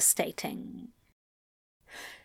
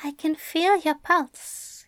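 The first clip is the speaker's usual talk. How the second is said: hushed, higher-pitched intimacy; whispered; 6 semitones higher than usual. A narrator's reading. Aziraphale nuzzling into Crowley's neck, whispered. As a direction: confide it close, half whispered. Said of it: "he whispers".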